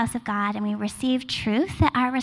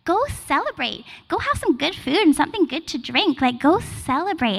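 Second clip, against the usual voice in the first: much higher bubbly voice cheering people up; playful Nehemiah; 6 semitones higher than usual. Retold or imitated. Nehemiah urging the weeping people to party, high and cheery. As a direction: play Nehemiah as an eager cheerleader. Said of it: "And Nehemiah's like".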